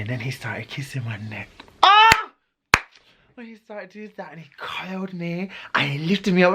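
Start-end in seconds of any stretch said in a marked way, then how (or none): 1.8-2.3 high excited squeal; giddy high yelp. Real, not acted. 3.4-6.5 hushed whisper, leaning in; gossiping whisper. Real, not acted. Breathy telling of lift.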